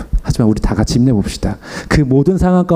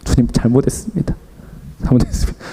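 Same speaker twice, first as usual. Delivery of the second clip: hushed, broken-voiced confession; mild retold weeping prayer; tearful repentance; 3 dB quieter than usual. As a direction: plead softly as if crying alone. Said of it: "혼자 울었어요".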